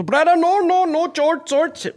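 high pleading "no, George"; imitating the attendant, high pitched; mock attendant. Retold attendant voice. (hoch nachgemachter Flugbegleiter)

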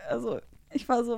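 ordinary talk

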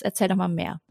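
ordinary talk

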